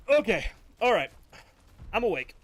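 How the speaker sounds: loud, high, startled recovery. Real, not acted. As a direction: shake it off loudly, startled. Loud recovery right after sneezing.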